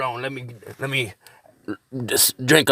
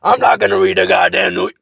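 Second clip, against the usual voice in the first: raised, louder gruff character voice; 8 semitones higher, 5 dB louder than usual. Acted. Vlogger testing his character voice. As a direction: slip into the character's voice. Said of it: "how did my voice get better?".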